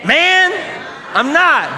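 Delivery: very high, loud squeal; mock panicked denial. Peter squeals his denial at the accuser, high and loud. Peter denying a second time.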